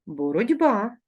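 Боротьба said with a voiced d before б, borod'ba; voiced d pronunciation. Shown as a demonstration. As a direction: say Боротьба as heard, d not t. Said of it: "Як ми вимовляємо".

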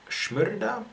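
slight Russian accent, rising high; a touch of Russian on the name. Real, not acted. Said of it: "a bit of a Russian accent by me right there".

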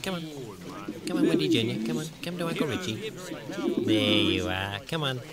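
soft, coaxing pet talk; soft sweet-talk to a dog. Acted. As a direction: lure the dog over gently.

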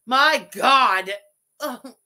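normal talking voice

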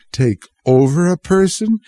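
frightened scream. A narrator's reading. Boy cries out in terror as the hands choke him. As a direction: burst out in terror. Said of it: "He screamed".

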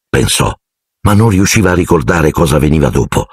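ordinary delivery, nothing marked

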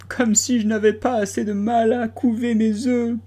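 high, plaintive lament; lamenting pigeon. A narrator's reading. Pigeon complaining about guarding eggs, high lament. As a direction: moan about the hardship. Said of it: "d'un ton lamentable".

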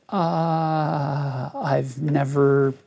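long drawn-out "uh", then halting answer; paused, stalling reply. Retold or imitated. Teacher caught off guard by a job question, hesitant stalling. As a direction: stall on the first sound, then feel your way through. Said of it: "I paused and said".

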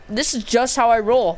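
ordinary talk, nothing marked

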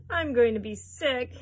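loud, slow, drawn-out wail; queasy yell. A narrator's reading. Missus T V turning green in the elevator, loud queasy yell. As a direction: yell it slowly, about to throw up. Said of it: "yelled Missus T V".

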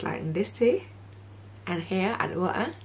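talking with lip held; finger pulling lip down. Real, not acted. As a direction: finger in mouth corner. Seen 0: Finger holds lower lip down, showing teeth, throughout the words.

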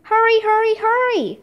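high, repeated urging; storyteller's high-pitched shout; narrated urgent shout. A narrator's reading. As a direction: hurry them along, calling out without full volume. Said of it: "Leonardo shouted".

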